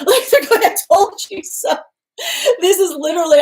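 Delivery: very high, loud laughing squeal; real giggles overtaking the mock quote. Real, not acted. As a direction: crack up while quoting someone. Cracking up voicing the smug quote.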